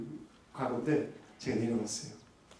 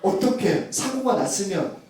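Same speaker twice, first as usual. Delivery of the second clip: louder, slow, indignant rebuke with 사고가 leaned on; wife's rebuke; 4 semitones higher, 5 dB louder than usual. Retold or imitated. Wife scolding husband on the phone, indignant. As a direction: scold him for not asking if she's hurt. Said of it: "아내가 저에게 이런 얘기를 했어요".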